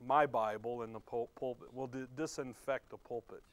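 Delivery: ordinary talk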